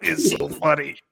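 real amusement, talking through laughter; cracking up at the joke; laughing. Real, not acted. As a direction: say it as the laugh bubbles over. Laughing at crude arena joke.

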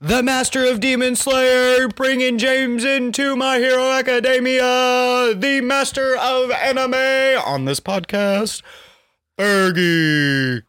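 high, slow, drawn-out hype announcement; announcer intro. Acted. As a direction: introduce him like a ring announcer. Hyped host introduces co-host.

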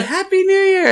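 high, bright New Year's cheer; cheerful greeting. Retold or imitated.

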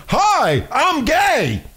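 high-pitched, exaggerated self-announcement; mock announcement.

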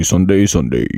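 loud announcer Sunday, Sunday; monster truck ad. Pretend. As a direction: pump it like a radio spot. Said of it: "when's the monster truck rally".

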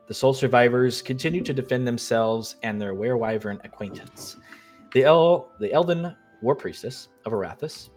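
normal talking voice

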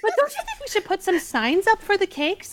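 very high, squeaky mock politeness. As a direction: imitate a pushy squeaky-voiced woman. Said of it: "little Miss Squeaky Voice".

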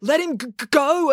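stammering, rising plea; boy urging his sister to let go. Acted. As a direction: stutter out the plea. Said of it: "urged Joe".

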